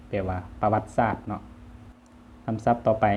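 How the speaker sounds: ordinary talk, nothing marked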